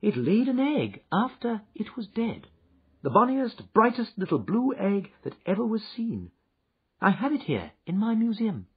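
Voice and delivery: Holmes voice. Acted. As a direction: show off the find like a proud collector. Holmes speaking in the story.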